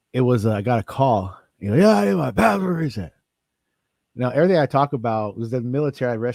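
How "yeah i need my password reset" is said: higher, put-on caller voice; imitating a caller. Caller asking the help desk, lightly voiced. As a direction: play the demanding caller lightly.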